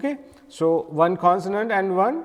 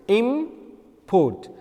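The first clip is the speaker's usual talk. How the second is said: IMport, im drawn out and higher; stress on first syllable; 4 semitones higher than usual. Shown as a demonstration. Teacher demonstrating the noun import, stress on IM. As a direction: strike the im of import, port after it. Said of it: "a stress on a word im".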